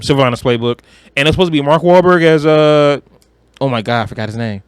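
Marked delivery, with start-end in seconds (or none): none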